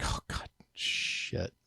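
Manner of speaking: slow, voiceless groan of annoyance; muttered dismay. Real, not acted. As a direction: breathe it out under your breath, slowly. Dismayed at needing a code.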